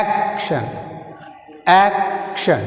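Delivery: "Action" with a held AC, then a falling ending; teacher modelling "Action", stressed at the start; first-syllable stress. Shown as a demonstration. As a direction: lean on AC in "Action". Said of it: "first syllable A".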